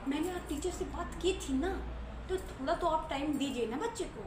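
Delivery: usual speaking voice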